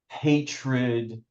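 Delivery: ordinary talk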